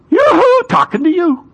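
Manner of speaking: very high, piping sing-out; comically unpleasant falsetto summons; silly yoo-hoo call. Acted. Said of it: "Hopefully his voice is a lot more pleasant than that".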